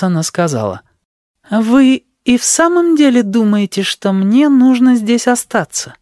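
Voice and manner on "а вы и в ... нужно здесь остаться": put-on coy young woman. Sheila asks coyly.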